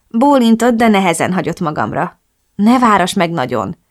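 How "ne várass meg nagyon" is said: plain reading voice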